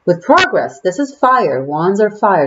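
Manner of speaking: ordinary talk, nothing marked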